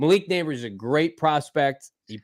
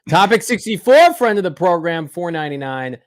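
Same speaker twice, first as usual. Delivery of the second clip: announcer read; 6 semitones higher, 8 dB louder than usual. Acted. The host announces a super chat donor in a big announcer voice.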